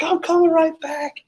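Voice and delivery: very high falsetto imitation; squeaky-high mock panic; panicked girlfriend. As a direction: mimic a frantic girlfriend in falsetto. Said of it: "She's like".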